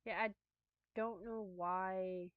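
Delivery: ordinary talk, nothing marked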